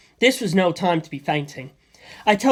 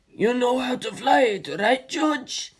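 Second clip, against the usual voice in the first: yelled; 7 semitones higher, slower than usual. A narrator's reading. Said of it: "Stephen yelled".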